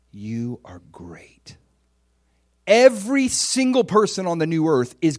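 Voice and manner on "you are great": slow, hushed, low pronouncement; quiet, weighty personal blessing; solemn Jesus. Retold or imitated. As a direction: say it almost in a whisper, word by word. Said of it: "looks at you in the eye".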